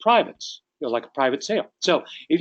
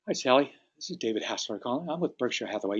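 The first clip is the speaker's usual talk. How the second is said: matched subdued state; 8 dB quieter than usual. Acted. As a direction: bring your energy right down, softly. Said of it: "match their emotional state".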